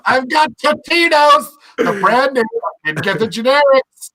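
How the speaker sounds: proud mother announcing snacks; sweet mom voice. Retold or imitated. Mom voice continues.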